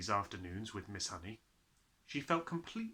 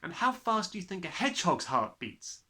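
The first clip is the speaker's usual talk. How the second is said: Matilda; 6 semitones higher, 5 dB louder than usual. Acted. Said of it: "Matilda asked".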